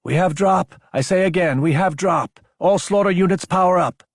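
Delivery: rally every unit to power up; urgent radio alert. Acted. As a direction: sound the alert over comms.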